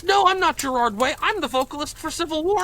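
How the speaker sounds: high, loud and fast indignant correction; indignant retort. Retold or imitated. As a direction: bristle at being mistaken.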